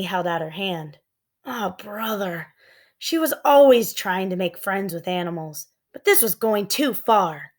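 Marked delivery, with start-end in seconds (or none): none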